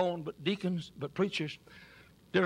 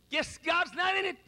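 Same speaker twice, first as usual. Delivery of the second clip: 1 mock doubting preacher; 12 semitones higher than usual. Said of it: "John Rice didn't say".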